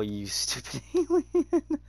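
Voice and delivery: high, slow giggling jeer; mock the creature through a laugh; laughing taunt. Real, not acted. Gamer jeering at the retreating alien, laughing and high.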